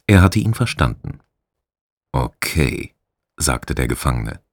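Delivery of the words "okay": soft, slightly lower okay; quiet. A narrator's reading. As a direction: breathe it out gently. Prisoner speaks softly to rat.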